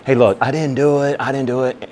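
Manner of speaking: hurried, mock-defensive denial; acted defensiveness. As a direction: plead innocence to an accuser. Defends himself to aggressive nurse.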